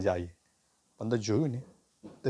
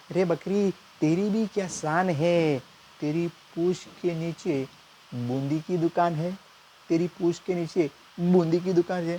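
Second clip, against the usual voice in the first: higher, slow, grand poetry recital; mock shayari recital; 6 semitones higher than usual. Nephew finishing the couplet to uncle, slow grand recital. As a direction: build to the punchline like a poet. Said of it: "હું શાયરીની ભાષામાં કહીશ".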